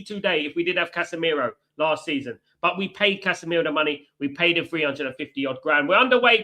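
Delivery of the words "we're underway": louder, higher kickoff call; excited. Real, not acted. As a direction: announce the kickoff with a jolt of excitement. Match kicks off live on stream.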